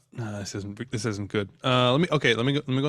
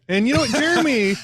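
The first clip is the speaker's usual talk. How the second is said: high squeaky voice; 12 semitones higher than usual. Acted. Jokey high outburst about Jeremy.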